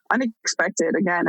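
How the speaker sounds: ordinary talk, nothing marked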